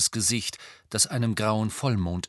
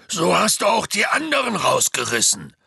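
loud, raised scolding; Hagrid; 9 semitones higher, 6 dB louder than usual. A narrator's reading. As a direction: shout a reproach up at a giant. (lautes Schimpfen)

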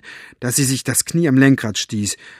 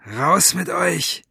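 slow, drawn-out order, pitched higher; commanding; 6 semitones higher, much slower than usual. A narrator's reading. Basta ordering the passengers out of the car, commanding. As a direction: order them out slowly, in a villain's voice. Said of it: "befahl er".

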